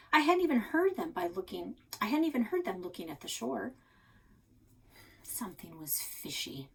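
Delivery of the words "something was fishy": softer, hushed and wary; suspicious whisper. A narrator's reading. Narrator girl realizing the trick, whispering suspiciously.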